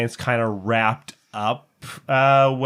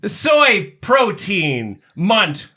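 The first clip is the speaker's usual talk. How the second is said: soy protein munt called out slowly and loud; slow loud attempt; 5 semitones higher, 3 dB louder, much slower than usual. Shown as a demonstration. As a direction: shout soy protein munt one word at a time. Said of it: "can I just get you to say".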